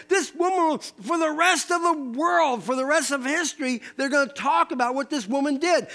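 Jesus proclaiming the woman's memorial. Retold or imitated. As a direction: announce it to the world. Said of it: "they couldn't believe that Jesus said".

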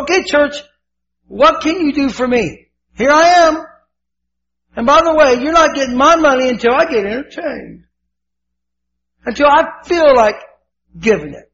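loud, high-pitched entitled churchgoer; demanding attitude. Acted. Churchgoer sits down and tells the church his terms, demanding. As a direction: lay down your terms to them, high and forceful. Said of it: "It's the attitude of".

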